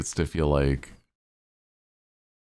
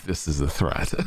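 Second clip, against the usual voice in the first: normal talking voice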